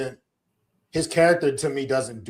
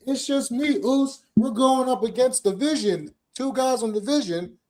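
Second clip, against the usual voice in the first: much higher, goofy hyped-up voice; mocking hype Jay; 10 semitones higher than usual. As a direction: play him as a silly party guy. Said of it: "he'll just be like".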